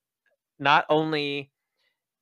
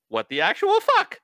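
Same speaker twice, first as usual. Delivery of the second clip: high-pitched mock outcry; incredulous, high exclamation; shocked disbelief; 4 dB louder than usual. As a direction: play the baffled bystander, pitch shooting up. Onlookers' shocked reaction acted out.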